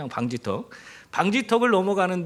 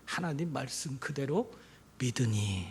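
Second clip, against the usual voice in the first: softer, lower 믿으니 almost intimate; preacher dropping to a hush; 5 semitones lower, 9 dB quieter than usual. Real, not acted. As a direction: draw them in quietly. Quiet answer after loud question.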